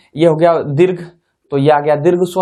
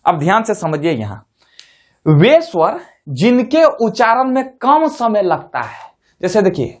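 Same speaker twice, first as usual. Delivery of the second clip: usual speaking voice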